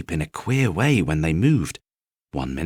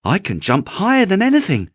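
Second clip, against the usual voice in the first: high, loud eager boast; little rabbit boasting; 13 semitones higher, 5 dB louder than usual. Acted. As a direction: puff yourself up bravely.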